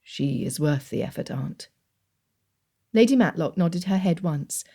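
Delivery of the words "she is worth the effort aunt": lower, measured gentleman's voice; Darcy answering his aunt; Darcy, reserved. A narrator's reading. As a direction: voice Darcy calm and proper.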